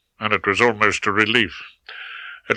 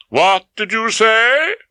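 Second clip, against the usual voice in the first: higher, louder and drawn out, calling through from the kitchen; called from another room; 12 semitones higher, 4 dB louder, slower than usual. A narrator's reading. Wife calling from the kitchen to her husband, raised and slow. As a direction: call it out from the next room, slowly. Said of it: "Hilda called from the kitchen".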